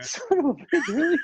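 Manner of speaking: high, exasperated disbelief; mock outrage. Telling how Titleist scolded him for a rival putter, a high exasperated outburst. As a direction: yelp it out in sudden indignant disbelief. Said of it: "I'm like".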